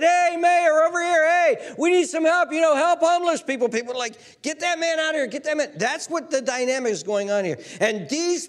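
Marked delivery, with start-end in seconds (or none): 0.0-3.7 high, loud heckling shout; calling out. Acted. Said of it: "and a homeless man said". 4.4-5.7 high, urgent onlooker; acted crowd indignation; alarmed ordering. Onlookers ordering man removed.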